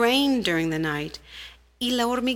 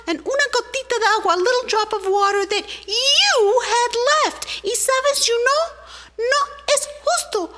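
very high, louder tiny ant voice, rushed complaint; indignant little ant; 13 semitones higher, 4 dB louder than usual. Acted. Little ant demanding justice from the rain, high and indignant. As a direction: squeak out an outraged demand.

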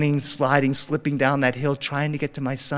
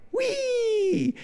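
put-on very high, long child's whee; imitated distant gleeful squeal. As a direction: squeal like a kid sliding away. Son sledding off, heard by father.

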